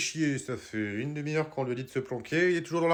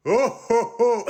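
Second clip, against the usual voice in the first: loud jolly ho ho ho; Santa ho ho ho; 7 semitones higher, 6 dB louder, slower than usual. Acted. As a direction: call out like Santa. Santa calls again. (ho ho ho du Père Noël)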